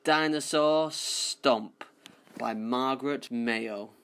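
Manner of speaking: ordinary delivery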